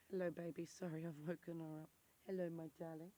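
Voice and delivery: quiet tender murmur to her daughter. Real, not acted. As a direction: coo gently at your little one. Mother greets her sleepy baby.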